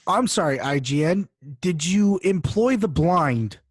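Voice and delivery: normal talking voice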